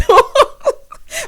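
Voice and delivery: loud, high-pitched laughter; gleeful high cackling; high cackle. Real, not acted. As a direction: crack up loudly at your own joke. Laughs at own Walmart joke.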